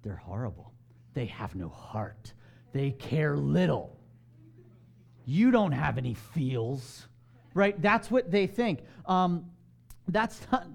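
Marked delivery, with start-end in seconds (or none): 0.0-7.1 slow, drawn-out mock accusation; accusing emotional critic. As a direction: act out an upset critic, stretching each word. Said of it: "those around you who are kind of emotional".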